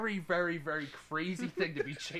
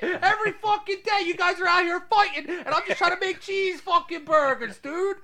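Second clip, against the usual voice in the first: high, loud, laughing rant of a fed-up cook; fed-up burger cook; 13 semitones higher, 7 dB louder than usual. Retold or imitated. Bald cook yelling at fighting kids, high and laughing. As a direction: yell like an exasperated Italian cook chasing kids off. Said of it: "He's like".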